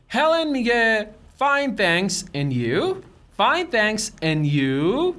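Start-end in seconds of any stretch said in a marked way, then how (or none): 2.3-2.9 "and you" lifting at the end like a question; rising question tune on "and you"; rising intonation. Shown as a demonstration. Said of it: "Notice the rising intonation on and you". 4.2-5.1 "and you" swooping up at the end; rising question tune on "and you"; rising intonation. Shown as a demonstration. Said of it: "Notice the rising intonation on and you".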